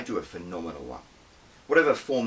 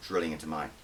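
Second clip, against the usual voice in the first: usual speaking voice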